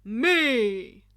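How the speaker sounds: huge loud triumphant yell; booming hero shout. A narrator's reading. All Might bursting in on the students, booming shout. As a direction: boom it like a hero's entrance.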